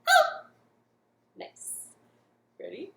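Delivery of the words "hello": quick high bright hello; fast hello. Shown as a demonstration. Host says hello as fast as she can, quick. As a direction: snap it out fast. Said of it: "Can you do that fast again".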